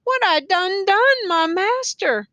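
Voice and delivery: high, drawn-out wail; acted tearful wail; crying lament. A narrator's reading. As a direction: plead to God in anguish. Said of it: "shaking her head and crying now".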